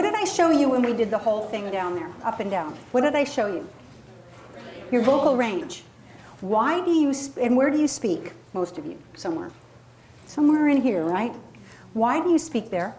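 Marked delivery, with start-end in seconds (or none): none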